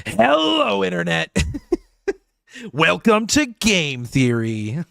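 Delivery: high, drawn-out hello, then a slow, booming welcome; hammed-up show-host greeting; mock YouTuber intro. Creator parodying a gaming channel intro, hammed-up host voice. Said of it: "like, a YouTuber voice".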